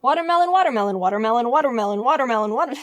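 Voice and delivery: fake background chatter. Shown as a demonstration. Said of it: "So we can talk about anything".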